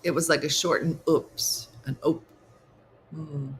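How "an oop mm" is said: ordinary talk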